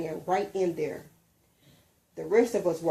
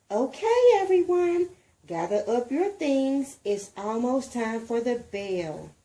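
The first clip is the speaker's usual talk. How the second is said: high, loud classroom call that settles; teacher telling the kids to pack up; teacher announcing; 5 semitones higher, 3 dB louder than usual. A narrator's reading. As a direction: gather the class up brightly.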